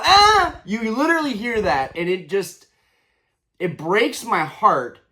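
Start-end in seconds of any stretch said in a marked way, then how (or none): 0.0-0.6 high, sharp imitated cry of pain; child's yelp. Vlogger imitates the kid's pained yelp after the slap. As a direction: yelp like a child who just got hit. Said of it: "you hear the kid go".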